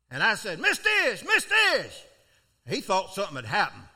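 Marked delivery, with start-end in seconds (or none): 0.6-2.0 high, loud urgent calling of a name; eager shout to a coworker across the yard; excited calling out. Retold or imitated. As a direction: call him across the yard, bursting with news. Runs to truck, calling out.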